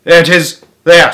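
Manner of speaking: loud excited shout. A narrator's reading. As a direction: point and yell it out. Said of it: "someone shouted".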